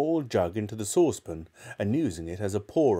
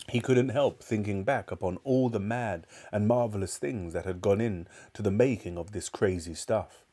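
usual speaking voice

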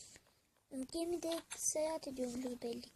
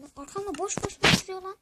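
louder, exasperated snap at the game; annoyed outburst; 4 semitones higher, 9 dB louder than usual. Real, not acted. Gamer fed up with a mob behind him, annoyed louder outburst. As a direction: blurt it out, fed up with something behind you.

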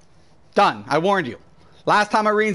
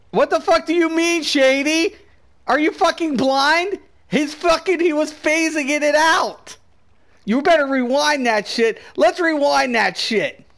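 higher, heated arguing; real indignation; 6 semitones higher than usual. Real, not acted. Streamer argues with viewer who doubts the glitch, indignant. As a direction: argue back hotly with raised pitch.

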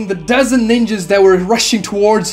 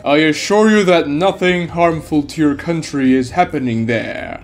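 masked figure, calm and cold; 3 semitones lower than usual. A narrator's reading. Masked stranger warning ninjas off, cold and calm. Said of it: "stares at them and says".

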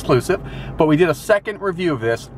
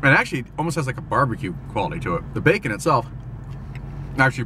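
talking between bites; muffled chewy speech; mouth full, chewing. Real, not acted. Seen 0: Bit burger at -1; holds it, lips working through the labelled words.

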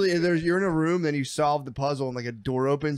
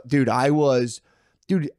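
ordinary talk, nothing marked

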